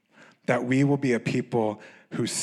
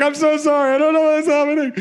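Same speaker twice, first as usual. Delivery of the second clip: higher, louder, teary mock apology; imitated crying apology; 8 dB louder, faster than usual. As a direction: replay yourself apologising through tears. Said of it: "I'm like".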